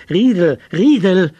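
loud, high repeated shout; urgent cry to a sleeper. A narrator's reading. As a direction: yell the name insistently. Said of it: "schrie Tegeljow schließlich".

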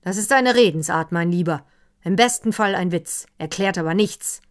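loud, irritated rebuke; an annoyed host, loud and curt; annoyed retort. A narrator's reading. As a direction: snap back at the clever remark, loudly. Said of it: "ärgerte sich darüber".

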